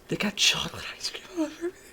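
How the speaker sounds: fast, breathless whisper; whispered aside. Real, not acted. Brother whispering the chocolate ice cream mess to the listeners, whispering.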